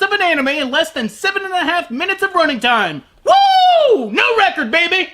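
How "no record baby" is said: normal talking voice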